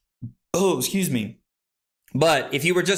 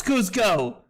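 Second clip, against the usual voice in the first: ordinary talk, nothing marked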